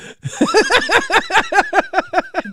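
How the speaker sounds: high-pitched burst of laughing; laughter. Real, not acted. Host laughs after his prank got no reaction, high laughter.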